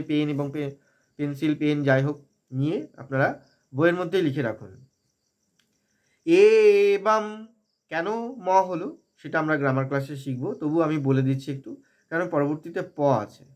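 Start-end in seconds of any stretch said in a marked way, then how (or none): 6.3-7.5 "এ বাম" with a very long higher "এ", closing on m. Shown as a demonstration. Teacher demonstrating the verse's first word, "এ বাম" drawn out. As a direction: chant "এ বাম", stretching the first vowel. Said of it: "একে দীর্ঘ করে নিয়েছি".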